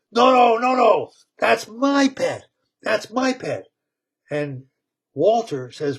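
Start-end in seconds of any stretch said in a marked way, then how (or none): 0.1-3.7 put-on high, loud childish no-no-no; shouted protest. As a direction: yell to keep the pet. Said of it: "Thunk says".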